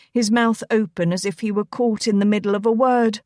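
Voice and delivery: ordinary delivery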